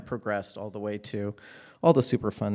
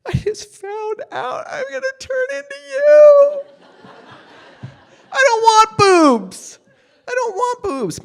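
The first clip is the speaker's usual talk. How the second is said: wailing, much higher and louder; crying shout; 14 dB louder than usual. Retold or imitated. Girl crying to her mom about growing up, crying shout. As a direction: sob it out like a kid in despair. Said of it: "And I'm like".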